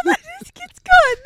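laughing. Real, not acted. Still laughing hard.

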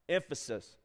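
ordinary delivery

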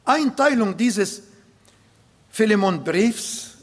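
usual speaking voice

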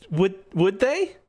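high, slow, hesitant hope; hopeful kid trainer. Acted. Young trainer eyeing the offered Squirtles, tentatively hopeful. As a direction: ask it haltingly, daring to hope. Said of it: "eyes light up".

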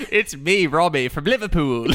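laughing, lightly put-on Liverpool accent; a playful hint of a Liverpool accent. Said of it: "you used a Liverpool accent".

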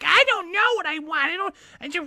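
high-pitched wail dissolving into babble; mock crying. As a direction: imitate a woman blubbering in squeaky falsetto. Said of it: "when she's crying".

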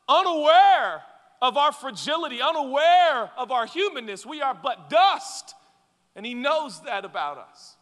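high, loud preaching climax; impassioned preaching. Acted. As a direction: pour it out at full force. Preaching at full force.

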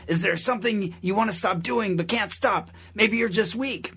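hammed-up preacher taunt; preacher impression.

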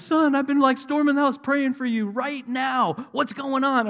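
high, loud wailing mother impression; mock bawling. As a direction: wail it like an overwrought mom on the phone. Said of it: "She just starts bawling".

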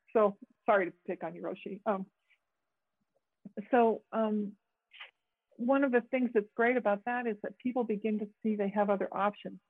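ordinary talk

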